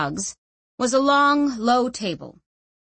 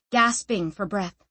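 ordinary delivery

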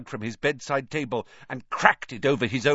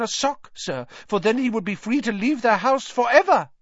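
high, squeaky elf voice; high-pitched humble little creature; meek house-elf; 12 semitones higher than usual. A narrator's reading. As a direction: squeak it meekly, matter-of-fact.

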